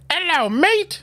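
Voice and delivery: a jokey Cockney greeting as George Washington; mock Cockney. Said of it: "that's my Cockney".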